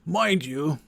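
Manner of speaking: put-on a creaky old sage's aside; old wizard voice. Merlin lecturing the Viking on druids, old wizard voice. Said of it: "the voice that I'm giving him".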